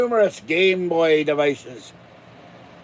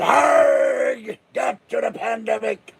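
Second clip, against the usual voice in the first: pirate rally cry; 5 semitones higher than usual. Pretend. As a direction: whip up the crowd like a pirate captain. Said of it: "And we all went".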